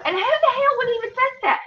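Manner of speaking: high incredulous scoff. Real, not acted. Vlogger scoffing at a troll in high disbelief.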